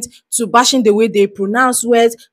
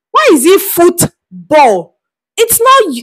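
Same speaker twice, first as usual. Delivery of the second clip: slow, higher, exasperated question; mock-outraged, higher and slow; incredulous; 6 semitones higher, slower than usual. Real, not acted. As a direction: drag it out in disbelief. Ranting at American football.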